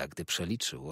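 ordinary delivery, nothing marked

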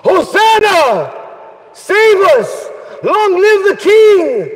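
high, slow acclaiming shout; crowd cheering. Retold or imitated. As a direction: cry out like the Jerusalem crowd. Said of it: "when he was entering into Jerusalem".

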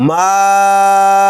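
"মা" held very long on one level note, then short "আন"; long madd stretch. Shown as a demonstration. Teacher reading out for learners, "মা" in "মাআন" greatly prolonged. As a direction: sustain "মা" steadily before brief "আন".

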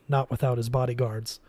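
usual speaking voice

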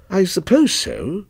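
drawn-out, unsure assent; doubtful. A narrator's reading. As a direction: agree without being convinced. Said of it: "he said doubtfully".

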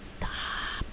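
soft whispered plea; barely voiced, under the breath; hushed. Real, not acted. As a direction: whisper it, drawing the vowel out. Playful repeated pleas to stop.

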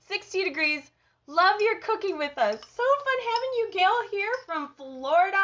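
high, loud, gushing welcome; excited greeting. Real, not acted. Host greets Gail from Florida, excited.

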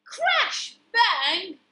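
loud, high storybook sound effect; startling and punchy; dramatic crash. A narrator's reading. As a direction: burst out with a sudden crash.

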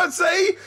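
squealing, breathless laughter; helpless high laugh. Real, not acted. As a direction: crack up at yourself, high and helpless. Laughs at his own ranting.

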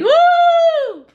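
high, loud triumphant woo; victory whoop. Real, not acted. Wife crowing over her husband after winning the argument, loud whoop. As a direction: whoop like you just won.

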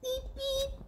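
squeaky high-pitched car horn beep. Acted. Sheep honking at the duck, high horn beep. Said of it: "he yells with a beep".

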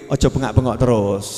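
low-pitched mock telling-off; father's admonishment. Annoyed father advising the mother to stop yelling, low admonishment. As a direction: drop low to advise the noisy wife. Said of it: "Nasihat ibunya".

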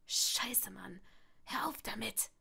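hissed, whispered swearing; whispered curse. Acted. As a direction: swear under your breath. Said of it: "fluchte Draco".

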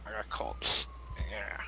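hushed whisper. Real, not acted. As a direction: whisper it under your breath. Whispering while sneaking.

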